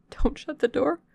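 urgent whispered plea; desperate whisper. Acted. Stranger begging the servant to keep the door open, whispered. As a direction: beg under your breath.

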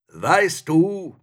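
high, booming-loud monster call; terrible spirit voice. A narrator's reading. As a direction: thunder it at a small human. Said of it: "rief er mit einer fürchterlichen Stimme".

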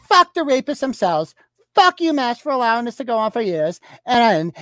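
high, declamatory imitation of an angry post; mock indignant reading. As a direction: read it out like a protest rant, mockingly. Mock-reads her angry Instagram post.

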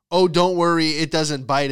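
loud, breezy mock reassurance; mock dismissive owner. Dog owner reassuring a wary stranger, loud mock breeziness. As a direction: imitate an owner waving away concern. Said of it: "is my favorite".

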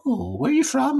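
put-on very high old-lady voice. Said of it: "the old lady was like".

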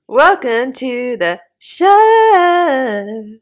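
high, loud, slow grand welcome; announcer voice. Acted. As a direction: boom it like a presenter.

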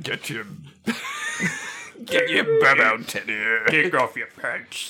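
strangled, leering comic mumble; Mister Bean. Pretend. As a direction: play a vulgar bumbling creep. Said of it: "sounds like vulgar Mister Bean".

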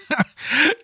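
sudden high burst of laughter. Real, not acted. While I'm laughing.

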